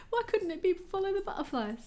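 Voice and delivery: high, hammed-up British accent; terrible British accent. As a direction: put on a bad British accent for a laugh. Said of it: "my terrible British accent".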